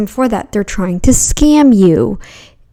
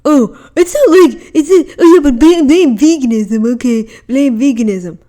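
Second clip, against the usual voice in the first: mocking angry vegan; 3 semitones higher, 4 dB louder than usual. Streamer mocking the vegan Ryan, louder with laughter. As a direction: ham up an angry vegan who blames the diet. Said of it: "You're just saying".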